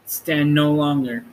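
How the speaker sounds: ordinary delivery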